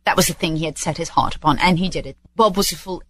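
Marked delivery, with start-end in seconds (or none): none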